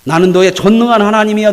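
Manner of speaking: loud scolding voice of God, booming. Acted. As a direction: thunder down on Abraham like an angry father. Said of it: "막 혼내요". (크게 꾸짖는 하나님 목소리)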